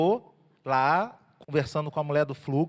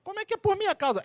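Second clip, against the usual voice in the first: much higher, incredulous protest; mock protest; 14 semitones higher than usual. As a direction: play it puzzled and indignant. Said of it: "Aí Jesus ia falar assim".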